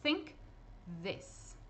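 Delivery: "Think" unvoiced, then "this" with a soft voiced th; th pair. Shown as a demonstration. Teacher modelling both th sounds back to back. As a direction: contrast the th of "Think" and "this". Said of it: "Assim que a gente pronuncia os sons".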